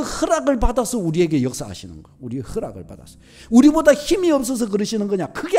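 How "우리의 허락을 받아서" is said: much softer, lower repeat of 허락을 받아서; a hushed afterthought; soft echo. Real, not acted. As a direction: let 우리의 허락을 받아서 fall away quietly. Quiet echo of key phrase.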